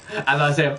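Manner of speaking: ordinary talk, nothing marked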